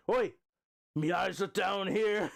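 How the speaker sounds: mock English accent breaking into a laugh; Pac. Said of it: "what region of England that accent is from".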